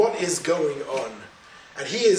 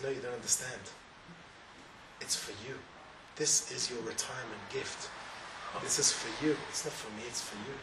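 gentle king; 6 semitones lower, 14 dB quieter than usual. Acted. King gently reveals the gift.

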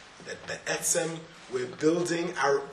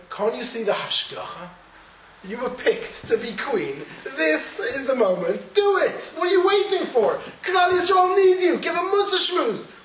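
passionate urging; 8 semitones higher, 3 dB louder than usual. Acted. Urging Esther as Mordechai.

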